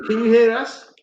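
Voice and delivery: ordinary talk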